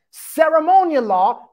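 "ceremonial" punched loud with a rising tune; added emphasis. Shown as a demonstration. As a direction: hit "ceremonial" hard and lift it. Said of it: "that's my emphasis right there".

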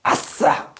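mock "yes!" in a sharp voiceless burst; imitated cheer. Teacher mimicking a student praised by an elder, mock voiceless cheer. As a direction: imitate a praised kid's cheer, breath only. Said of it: "윗사람이 칭찬하면 가서".

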